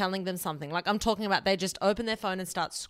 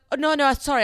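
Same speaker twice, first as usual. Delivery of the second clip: loud, higher-pitched blurted apology; hurried mock apology; 6 semitones higher, 8 dB louder than usual. Imitating the friend apologising for butting in, flustered.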